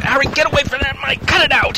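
shouting. Acted. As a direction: yell at him to stop. Ventriloquist quarrel, shouting.

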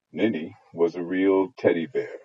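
plain reading voice

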